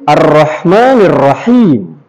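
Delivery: "Arrahmanirrahim" slow, with held R and long vowels; drawn-out, emphasized "Arrahmanirrahim", falling at the end. Shown as a demonstration. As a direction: press and stretch the syllables of "Arrahmanirrahim". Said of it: "benar-benar ditekankan di daerah situnya".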